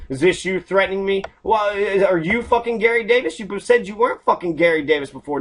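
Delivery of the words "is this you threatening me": ordinary delivery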